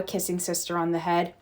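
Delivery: ordinary delivery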